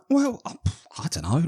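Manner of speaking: evasive dodge. Retold or imitated. Evasive self-quote, pitch jump.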